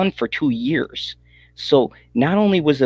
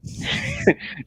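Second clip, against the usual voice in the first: high laugh; 6 dB quieter than usual. Real, not acted.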